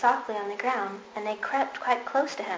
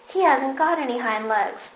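raised voice, higher pitch; calling out; 4 semitones higher, 5 dB louder than usual. A narrator's reading. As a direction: call it out across the field. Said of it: "he called out".

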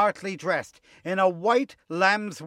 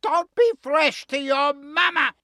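high, loud scolding falsetto; highest voice; 10 semitones higher, 5 dB louder than usual. Acted. Wolf scolds sheep as her mama, highest voice. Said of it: "in his highest voice".

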